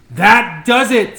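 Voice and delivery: put-on boy blowing up at the mess; fed-up outburst. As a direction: dig in and declare you've had it.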